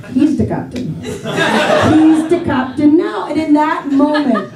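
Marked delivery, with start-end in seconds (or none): 0.1-3.3 deflated pirate line. Retold or imitated. Hat stolen, echoing the pirate line.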